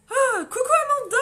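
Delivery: high, loud delighted greeting; excited call. Real, not acted. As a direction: call out to a friend with joy. Salue une viewer en criant. (cri de joie aigu pour saluer Amanda)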